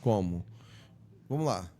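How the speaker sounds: changed tone. Shown as a demonstration. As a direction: question them to break the defeated mood. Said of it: "Você já dá um outro tom". (outro tom, mais grave e direto)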